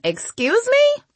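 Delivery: very high, drawn-out rising question; affronted. A narrator's reading. Girl told to close her legs, affronted. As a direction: squeak it out, offended. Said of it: "She closed them and frowned".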